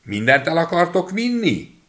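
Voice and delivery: high, loud little voice calling out; little boy's high shout; shouting as loud as he can. A narrator's reading. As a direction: yell it up high to wake the house. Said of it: "kikiáltott, amilyen hangosan csak bírt".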